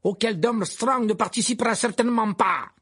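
high, loud, indignant headmaster; Karkaroff flaring up. A narrator's reading. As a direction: snap back in outrage. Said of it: "s'emporta Karkaroff". (Karkaroff s'emporte, voix haute et forte)

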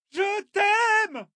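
put-on very high, ringing girl's cry; shouted, clear. A narrator's reading. Girl to her departing friend, shouted love. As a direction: cry it out clearly, stretched across the water. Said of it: "cria Aîné".

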